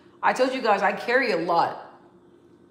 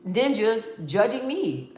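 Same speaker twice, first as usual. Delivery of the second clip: drawn out, carefully slow; deliberately slow substitute; slow censored word; 3 semitones higher, much slower than usual. Real, not acted. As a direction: slowly swap in the clean word. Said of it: "I'm gonna say N I G G".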